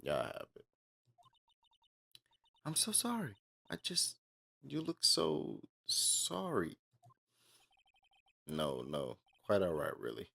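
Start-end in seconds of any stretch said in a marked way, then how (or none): 2.6-6.8 much higher, slow, drawn-out mock apology; silly character voice. Gamer voicing a character's fake apology, high and slow. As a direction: play the apologetic game character high and stretched out. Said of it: "Why is he talking like this?".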